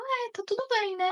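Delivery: put-on a small boy checking it's okay; relieved little boy. Son asking his mother if it's really okay, high child voice.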